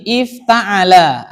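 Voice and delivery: "ifta'ala" drawn out by syllables; measured classroom "ifta'ala"; slow model reading. Shown as a demonstration. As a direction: sound out "ifta'ala" slowly. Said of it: "Ada fi'il madhi".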